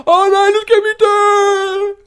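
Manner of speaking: very high, slow, drawn-out mock scream; loud falsetto cry of exaggerated terror; mock panicked shout. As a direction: wail it like a hammed-up panicking sidekick. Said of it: "han råber".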